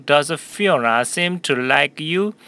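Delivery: slow, even model sentence for repeating; teacher modelling a line slowly for the class; slow model reading. Shown as a demonstration. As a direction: read it slowly so students can follow. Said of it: "speak out 한번 같이 해볼까요?".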